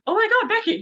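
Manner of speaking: high, loud mock squeal; excited greeting. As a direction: re-enact a thrilled squeal of recognition. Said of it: "I was like".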